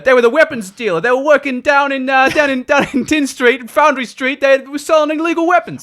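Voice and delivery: high-pitched New York wise guy; hammed-up New Yorker weapons dealer; New Yorker accent. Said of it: "someone with a New Yorker accent".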